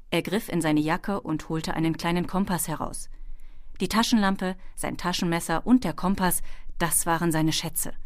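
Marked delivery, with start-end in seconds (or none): none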